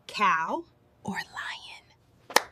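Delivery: slow whispered question. Acted. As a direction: whisper the two choices slowly. Whispered take before voiced repeats.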